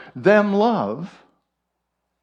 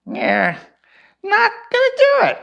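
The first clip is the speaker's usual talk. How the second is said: high squawking parrot voice, fast and loud; 5 dB louder than usual. Acted. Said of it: "the parrot would squawk".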